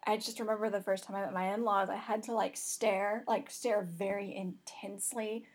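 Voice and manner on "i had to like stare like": ordinary delivery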